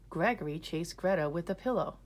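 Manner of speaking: ordinary delivery, nothing marked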